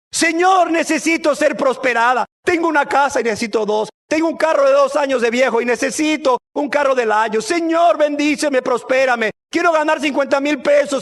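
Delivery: high, insistent begging for riches; hammed-up needy petitioner; mock pleading prayer. Mock selfish prayer to Jesus.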